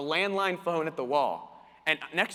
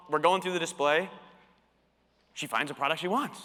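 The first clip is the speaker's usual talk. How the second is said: ordinary talk, nothing marked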